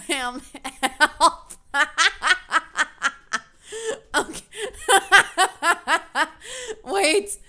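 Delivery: breaking into helpless laughter; laughing too hard to read. Real, not acted. Cracks up at game.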